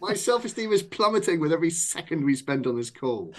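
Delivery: very high, hammed-up wail; mock anguish. Author begging a friend on the phone, mock anguish. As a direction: plead in comic despair, pitch way up.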